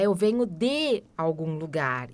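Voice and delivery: ordinary talk, nothing marked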